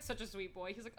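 ordinary talk

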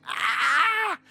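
high, drawn-out mock scream; goat-like scream. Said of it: "Yo grité como chivo". (grito agudo como de chivo)

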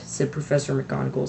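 ordinary delivery

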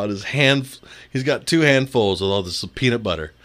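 ordinary talk, nothing marked

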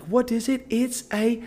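put-on high, sing-song British kids' presenter; Steve and Maggie. Kids' video host teaching a word, high British accent. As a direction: imitate a British children's video host, bright high pitch. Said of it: "it was like a Steve and Maggie video on YouTube Kids".

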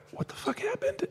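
shocked squeak. Retold or imitated. Players shocked by injured fan.

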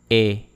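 E said as a French closed "ay" without glide. Shown as a demonstration. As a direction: say E as a clear, held French é. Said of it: "is pronounced like this".

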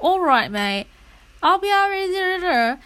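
loud, high mock British accent; put-on British accent. Copying someone they talk to, exaggerated British accent. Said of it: "that has a British accent".

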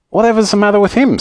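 high, quick puzzled question; alarmed question. A narrator's reading. Joe hearing yells overhead, alarmed and puzzled. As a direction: ask it fast in mild alarm.

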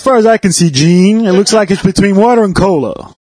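wrestling announcer calling a knockout; lightly voiced ringside commentator. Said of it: "I wanna do the announcer voice".

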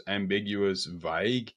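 ordinary delivery

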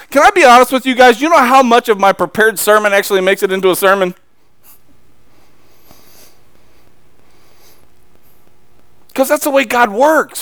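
loud, high, driving sermon; full-force preaching. Acted. Preacher rousing his congregation, loud and fervent. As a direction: preach it hard to the pews.

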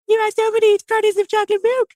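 high, squeaky mock girlfriend voice; mocking girlfriend impression. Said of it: "goes like this".

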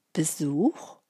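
stunned one-word question, rising; surprised. A narrator's reading. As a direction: caught off guard, asking back. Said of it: "Der Bauer riss die Augen auf".

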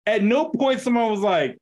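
ordinary talk

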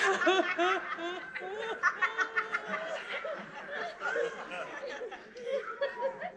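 a long rising laugh, high and thin; high breathy laugh. Real, not acted. Preacher laughing at her own voiceless laughter. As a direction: laugh out high and soft, almost silent. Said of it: "No one could hear me".

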